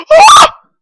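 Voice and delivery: high, loud scream of fright; startled scream. Real, not acted. Gamer suddenly startled by the monster, screaming in fright. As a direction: jump and shriek in terror. Sudden scare in horror game.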